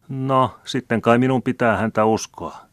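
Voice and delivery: plain reading voice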